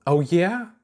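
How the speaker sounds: high, skeptical "oh, yeah?"; skeptical comeback. A narrator's reading. Chuck doubting Ponder's innocence, skeptical and high. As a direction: throw it back with doubt. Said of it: "Chuck shot back skeptically".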